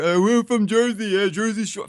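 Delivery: high, sneering Staten Island guy imitation; mock Staten Island accent. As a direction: mimic fake Jersey boasting in a Staten Island accent. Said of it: "Those guys are from Staten Island".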